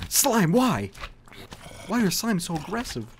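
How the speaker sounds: high, loud protest; exasperated outcry. Real, not acted. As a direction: cry out at the slime's betrayal. Slime attacks him in game.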